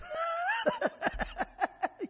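high, long staccato guffaw; mocking high-pitched cackle; guard's laughing fit. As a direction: break into hammed-up hysterical laughter. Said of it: "התחיל לצחוק".

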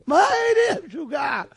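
high, loud acted scolding; exasperated shriek. Wife answers husband's discovery cry, exasperated shriek. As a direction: scream back as the fed-up wife.

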